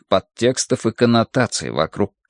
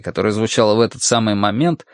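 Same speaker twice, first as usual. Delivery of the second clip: ordinary delivery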